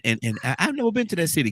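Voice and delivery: ordinary talk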